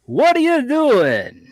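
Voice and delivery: high, slow, loud teasing call. Real, not acted. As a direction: stretch it out to mock. Teasing Val loudly.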